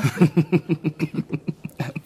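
a brief amused chuckle; short laugh. Real, not acted. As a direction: laugh lightly at the toast. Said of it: "U, u lacht?". (kort lachje)

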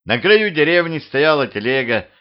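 usual speaking voice

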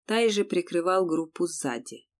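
ordinary delivery, nothing marked

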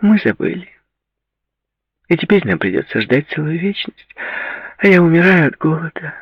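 high-pitched pouting complaint; plaintive. A narrator's reading. Hungry girl complaining she forgot to book, plaintive. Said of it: "грустно сказала Ширли".

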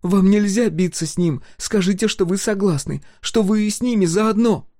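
put-on high, loud pleading child; pleading girl. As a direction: beg the coach urgently. Sol pleads with coach.

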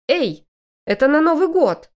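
loud, sharp scolding; stern housewife scolding. A narrator's reading. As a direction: snap like a strict Soviet hostess. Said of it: "строгую советскую хозяйку дома".

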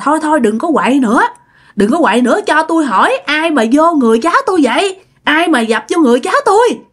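acted challenge to the intruding spirit; stern confrontation. Aunt confronts the possessing spirit. (giọng gắt tra hỏi vong)

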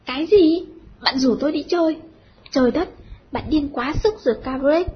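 put-on loud, outraged girl's outburst; chu chéo. A narrator's reading. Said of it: "Mua bất thần chu chéo". (la toáng lên đầy sửng sốt)

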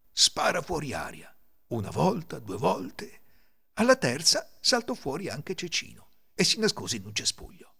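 usual speaking voice

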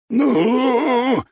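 high, loud, drawn-out strain; effortful arm-wrestler's cry; straining grunt. A narrator's reading. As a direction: push it out with all your might. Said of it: "прохрипел небоскрёб".

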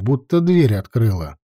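ordinary delivery

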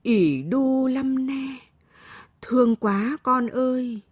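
high, slow, drawn-out sorrow; pitying lament. A narrator's reading. Grandmother aches for her scratched-up granddaughter, pitying lament. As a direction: croon it with aching pity. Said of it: "Bà nhìn đứa cháu gái xót xa".

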